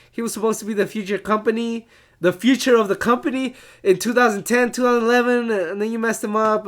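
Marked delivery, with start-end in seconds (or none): none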